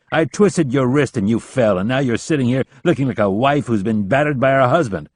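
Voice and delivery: loud, contemptuous dressing-down; berating. A narrator's reading. Rapp berates Leland, loud and scornful. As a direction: tear into him.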